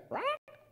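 high squawking parrot cry. Acted. Parrot to thief, high squawk. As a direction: squawk it like a bird. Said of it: "The parrot went".

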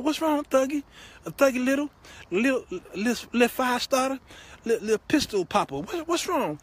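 high-pitched mock fussing over him; baby him in a put-on high voice; concerned girlfriend. Girlfriend comforting her crying thug, high mock concern.

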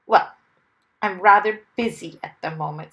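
loud, mildly self-important reply; trying to sound important. A narrator's reading. Mister Wumble to the smaller man, self-important. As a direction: put on a touch of importance. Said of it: "trying to sound important".

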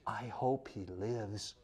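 धीमी, बहुत हल्की और धीमी गति की फुसफुसाहट; कान के पास धीरे से फुसफुसाना; retold whisper.

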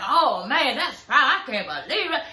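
mocking a man's fake outrage; overdone shock. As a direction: ham up the surprise for the cameras. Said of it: "He really starting to overdo it".

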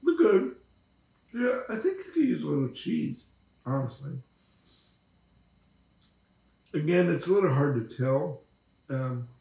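talking with food in mouth; speaking between bites; chewing while talking. Real, not acted. Seen 1: Holds bitten pastry, jaw working between phrases while speaking.

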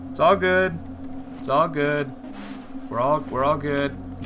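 loud breathless self-reassurance; reassuring. Real, not acted. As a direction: talk yourself down, high and loud. Calming down after scare.

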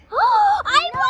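high triumphant cry; excited shout. Real, not acted. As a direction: gasp and shout the win. Win announced with gasp.

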